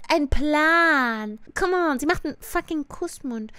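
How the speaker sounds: ordinary delivery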